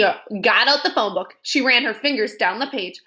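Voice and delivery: ordinary delivery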